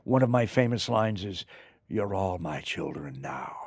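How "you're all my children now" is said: put-on low, slow and soft, "You're all my children now" intoned like a god; grand villain declaration. Shown as a demonstration. Actor recalling how he said the line in the movie: low, slow declaration. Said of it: "Well, that's wrong".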